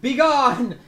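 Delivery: loud, high banishing shout; commanding cleric. Acted. Cleric to zombies with holy symbol, commanding shout. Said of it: "and she says".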